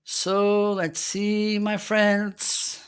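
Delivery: high, slow singsong; slow, high-pitched lilt; drawn-out suspense. Real, not acted. Drawn-out filler while testing mute.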